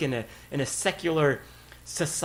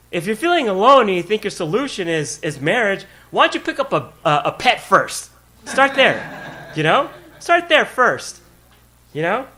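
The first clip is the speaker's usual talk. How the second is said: ordinary talk, nothing marked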